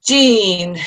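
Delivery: usual speaking voice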